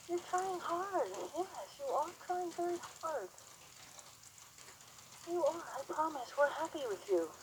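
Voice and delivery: high, soft singsong praise; soothing praise to horse. Real, not acted.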